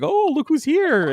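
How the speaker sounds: high, drawn-out mock welcome; mock delighted surprise. Host introducing a surprise guest, high mock delight.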